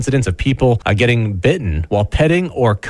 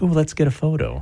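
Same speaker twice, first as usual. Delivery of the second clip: soft, lightly raised tourist voice; softer mock excitement; 5 semitones higher, 5 dB quieter than usual. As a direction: lightly imitate a keen tourist, softly. Voicing tourists spotting a turkey.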